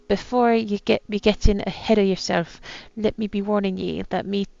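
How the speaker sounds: attempted pirate accent, reading aloud. Captain writes his last words, attempted pirate accent. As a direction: read it like an old pirate captain. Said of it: "my attempt at a pirate accent".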